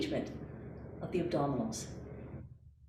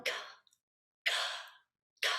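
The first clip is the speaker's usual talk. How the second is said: short k sounds gasped through a smile; smiling k gasp; 8 dB quieter, much faster than usual. Shown as a demonstration. Teacher showing the k gasp with a smile. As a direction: keep the smile open and flick out each k. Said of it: "And smile".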